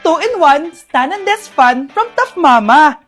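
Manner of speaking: brash mum-style ad read; loud product pitch. Acted. Mother pitching a fan to her kids, loud ad read.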